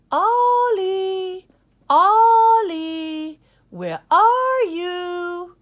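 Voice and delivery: very loud, high calling across a field. Acted. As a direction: call out into the snow. Family calling lost pup.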